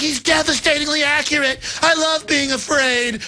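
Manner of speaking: high, swooning mock fan voice; mock adoring crowd. Host mocks the crowd adoring fear in a swooning voice. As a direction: swoon over him like a scared fan. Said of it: "everybody's like".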